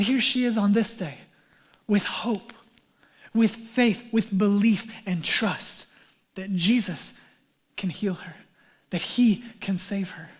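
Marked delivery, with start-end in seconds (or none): none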